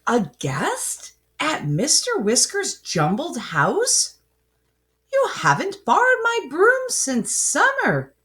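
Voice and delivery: high, swooping, incredulous scolding; storybook grandmother's high-pitched reproach; chiding. A narrator's reading. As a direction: chide with shocked disbelief, pitch leaping up. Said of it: "she chided".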